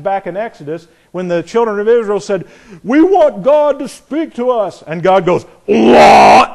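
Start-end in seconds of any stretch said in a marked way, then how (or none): 2.8-4.8 high, slow mock plea to God; pleading Israelites. Preacher voicing Israel asking God to speak, mock plea. 5.7-6.5 loud, high mock shout from God; booming What. Preacher voicing God answering Israel, a loud mock shout. God's reply, acted.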